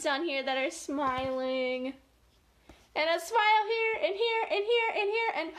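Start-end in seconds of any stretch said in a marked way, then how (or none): 3.0-5.5 high, bubbly pointing-out; gleeful, pitched-up listing; excited counting-off. Real, not acted. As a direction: pipe each one up with rising delight. Excitedly points out each smile.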